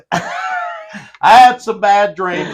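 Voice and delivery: laughing. Real, not acted. Laughing admission of bad dreams.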